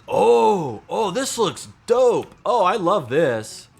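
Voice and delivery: loud, high, drawn-out gushing; thrilled outburst of approval; excited delight. Real, not acted. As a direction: gush over it, stretching the words slowly. Delighted reaction to his own build.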